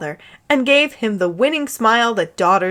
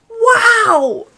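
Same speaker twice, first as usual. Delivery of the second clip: much higher, awed wow; shout in awe; 13 semitones higher, 4 dB louder than usual. Acted. Harry amazed by the prayer magic, high awed shout. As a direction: gasp it out wide-eyed. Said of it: "Harry shouted in awe".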